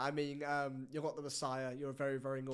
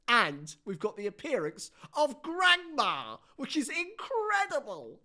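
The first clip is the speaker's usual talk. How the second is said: excited build-up; 9 semitones higher, 7 dB louder, slower than usual. Real, not acted. Hyping the grandma guest.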